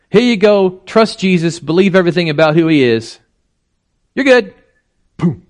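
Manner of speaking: high, breezy sales voice, rising to "good"; hammed-up flippant send-off; mocking glib pitch. Preacher mocking a shallow gospel to the congregation, high and glib. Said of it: "mocking shallow gospel".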